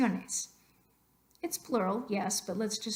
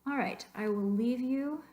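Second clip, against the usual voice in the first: usual speaking voice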